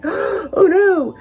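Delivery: high, loud startled "oh no"; alarmed gasp. Retold or imitated. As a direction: react like you just heard bad news. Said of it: "And I'm like, gasps".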